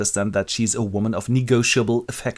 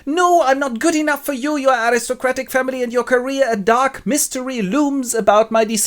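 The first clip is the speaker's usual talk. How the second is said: high-pitched, sustained refusal; melodramatic young lady; 12 semitones higher than usual. Acted. Young lady declines the proposal, high and melodramatic. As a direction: refuse him in a high, dramatic voice.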